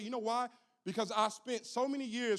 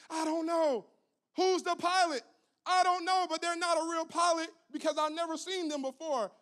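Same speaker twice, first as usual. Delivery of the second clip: high, louder flustered flight attendant; helpless flight attendant; 8 semitones higher, 4 dB louder than usual. Retold or imitated. Attendant answering frightened passenger, flustered and high. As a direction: blurt it out helplessly.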